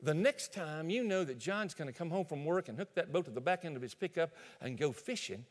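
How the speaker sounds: ordinary talk, nothing marked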